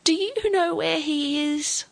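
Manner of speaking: high, pleading question; piteous hope. A narrator's reading. Grandfather begs Olivia for news of his father, piteous hope. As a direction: sad hope lifting the voice high. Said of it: "with a sad, piteous hope".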